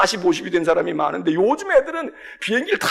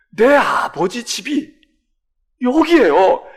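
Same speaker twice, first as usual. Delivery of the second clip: slow, louder firm statement; emphatic declaration; 2 semitones higher, 6 dB louder, much slower than usual. Acted. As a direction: declare each word deliberately. Jesus declaring slowly.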